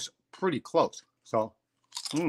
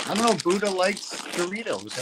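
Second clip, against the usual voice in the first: playful high voice; 8 semitones higher than usual. Real, not acted. Joking about Buddha and Doritos.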